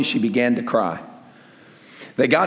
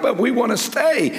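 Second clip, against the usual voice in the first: higher-pitched, pleading objection; mock protest; 8 semitones higher than usual. Preacher voices disciples begging Jesus to stay, mock pleading. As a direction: plead like reluctant followers told to leave.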